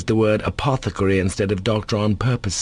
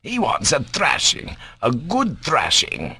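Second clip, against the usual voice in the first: high, slow, emphatic fury; furious; 6 semitones higher, slower than usual. A narrator's reading. Doctor says the boy wants thrashing, furious. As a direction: stretch out each threat with relish. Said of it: "stamped in a perfect fury".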